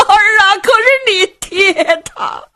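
put-on an old mother's grief spilling over; breaking into sobs. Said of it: "老太太放声痛哭".

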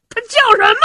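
high, loud startled cry; startled shout. Acted. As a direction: jump up in shock and demand the name. Zhao Hu leaps up, startled. (惊讶地高声追问)

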